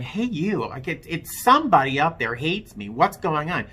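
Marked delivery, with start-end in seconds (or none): 0.0-0.7 high, half-hearted mock yell of Hey, you; retold yell. Drunk, calling out to his higher power, mild mock yell. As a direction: call up to the sky, lightly imitated. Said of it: "and so I'm yelling".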